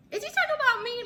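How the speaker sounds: very high, loud disbelief; astonished question. Retold or imitated. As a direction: squeal it in amazed disbelief. Acting out her astonished reaction.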